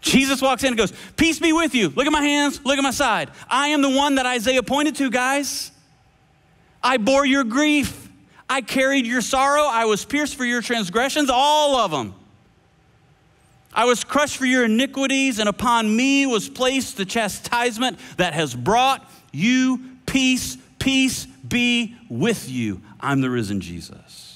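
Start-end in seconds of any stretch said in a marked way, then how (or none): 1.2-20.6 higher, fervent, showing his wounds; earnest Jesus. Acted. Jesus showing his hands and side to the disciples, fervent and higher. Preacher acts out Jesus in the room. 20.8-24.3 a hush of peace; slow tender blessing. Acted. Jesus blessing his frightened friends, slow and tender.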